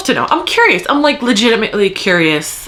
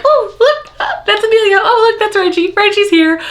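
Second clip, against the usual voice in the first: excited recognition; 13 semitones higher than usual. Retold or imitated. Reader greeting favorite characters popping up in books, excited. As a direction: act out spotting favorites, voice shooting way up. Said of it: "When they pop up in other books, I'm like".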